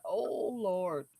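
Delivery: hushed, mortified muttering; soft, low parent's dismay; embarrassed aside. Retold or imitated. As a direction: drop your voice and lament under your breath. Embarrassed parent reacting to loud son.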